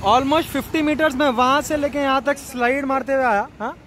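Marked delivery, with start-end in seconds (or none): none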